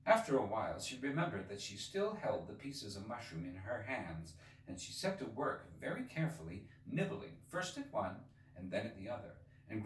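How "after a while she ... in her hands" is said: ordinary delivery, nothing marked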